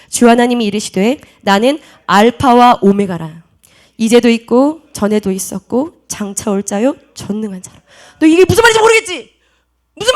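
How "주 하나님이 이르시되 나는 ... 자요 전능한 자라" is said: slow, low, measured declaration; solemn scripture reading. Acted. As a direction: read out God's words slowly and deliberately. Reads scripture aloud as God's words.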